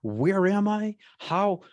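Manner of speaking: high, slow, dazed questioning; stretched-out, high-pitched disbelief, re-enacted; acted bewilderment. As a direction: ask as if utterly lost and disoriented. Acts out own bewilderment on waking.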